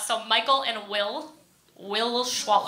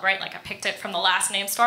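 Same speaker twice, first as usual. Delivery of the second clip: ordinary talk, nothing marked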